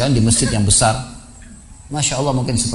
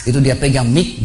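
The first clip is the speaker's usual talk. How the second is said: ordinary talk, nothing marked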